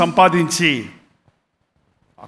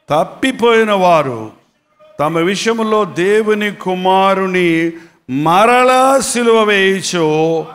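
slow, weighty scripture reading; 3 dB louder, slower than usual. Acted. Preacher reads Hebrews to the church, slow and solemn. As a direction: read each phrase like holy writ.

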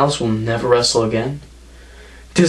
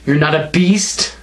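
ordinary talk, nothing marked

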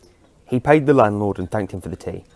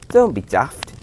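louder, higher, scoffing protest; crying out; 5 semitones higher, 5 dB louder, slower than usual. Acted. Jack scoffing at the old man's time travel claim, loud. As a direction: laugh off a crazy claim out loud. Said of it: "he cried".

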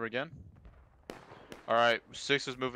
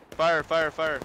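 loud, higher repeated fire order; shouting; 5 semitones higher, 6 dB louder, slower than usual. Real, not acted. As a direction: yell fire over the noise.

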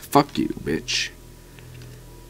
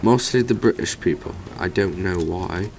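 mock British accent, lilting upward; 2 semitones higher than usual. Shown as a demonstration. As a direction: put on a playful British accent. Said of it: "speaking with a British accent".

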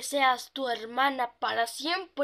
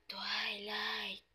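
spooky whisper of the name; 4 semitones lower, 9 dB quieter than usual. Acted. The ghost calls Twilight from the dark in a spooky whisper. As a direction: breathe the name like a hidden ghost.

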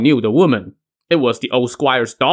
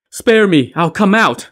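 high, raised begging cry; woman's shouted plea; 7 semitones higher, 4 dB louder than usual. A narrator's reading. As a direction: plead loudly for your life. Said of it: "the woman shouted".

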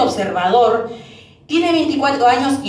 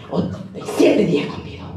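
casi susurrado, como escondido; dicho por lo bajo; 6 semitones lower, 5 dB quieter, faster than usual. Pretend. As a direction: suelta el remate en voz baja. Remate de chiste. (remate en voz baja y sin voz)

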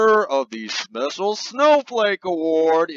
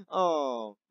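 ordinary delivery

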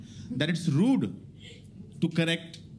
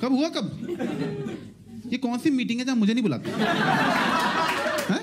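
mock outrage; 9 semitones higher than usual.

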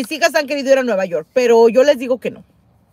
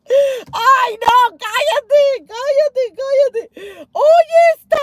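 high panicked cries of disgust; high shrieking no, shut up; 12 semitones higher, much slower than usual. Real, not acted. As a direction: squeal in real horror. Reacting to a mouse memory.

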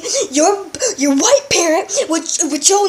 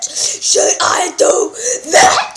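slow menacing repeat; 3 semitones higher, much slower than usual. Acted. Dad slowly repeating the threat to his son, drawn out. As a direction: stretch every word of "Should I do that?" out slowly.

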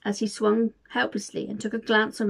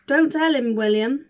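mumbled; 4 semitones higher, 5 dB louder than usual. Acted. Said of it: "Santa mumbled".

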